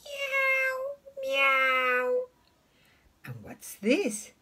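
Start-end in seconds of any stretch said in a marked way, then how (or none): 0.0-2.2 very high, thin kitty meow; squeaky high-pitched meowing; cat meow. Retold or imitated. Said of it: "what does the cat say".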